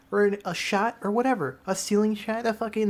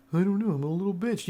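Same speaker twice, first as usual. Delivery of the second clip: lightly voiced talking car; a hint of a put-on car voice; car's reply; 2 semitones higher, faster than usual. Car answering the player who asked why, lightly put-on voice. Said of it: "You see, he answered it".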